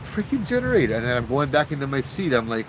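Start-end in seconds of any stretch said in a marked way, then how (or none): 0.1-1.0 exasperated surprise. Retold or imitated. Startled by generator noise.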